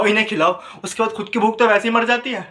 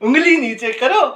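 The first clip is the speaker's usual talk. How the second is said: high, slow mock command; drawn-out, pitched-up scolding parody; commanding woman; 6 semitones higher, 4 dB louder, slower than usual. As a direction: imitate a partner's sudden stern order, stretched out. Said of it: "तब एक आवाज़ आएगी".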